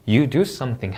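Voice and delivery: "You do something" falling to a close; falling tune. Shown as a demonstration. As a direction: let "something" drop in pitch to finish. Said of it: "내려 읽어주시면 되겠습니다". ("something"을 내려 읽기)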